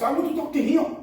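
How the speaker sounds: firm demand. Retold or imitated. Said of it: "I didn't say it like that".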